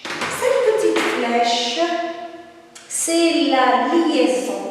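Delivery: slow, deliberate teacher explanation; slow explaining. Shown as a demonstration. Teacher to pupils, explaining the arrow slowly. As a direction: lay it out slowly for young pupils. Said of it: "teacher explaining to pupils".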